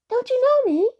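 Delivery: high, loud, dismayed question; pixie's cry. A narrator's reading. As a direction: cry out in a small creature's high voice. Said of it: "cried the pixie".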